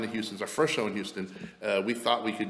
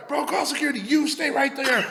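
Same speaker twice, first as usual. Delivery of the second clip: high, urgent mock shout; hammed-up yelling with a laugh; imitated panicked yell; 5 dB louder than usual. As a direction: shout high, drawing out the last words. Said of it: "I hear Ken yell".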